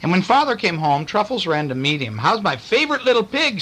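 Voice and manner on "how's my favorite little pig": cheerful daddy. A narrator's reading. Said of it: "said Daddy".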